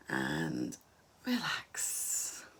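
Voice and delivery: hushed, breathy release; relaxing cue. Shown as a demonstration. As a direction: let the word sink into a near whisper. Leading relaxation exercise.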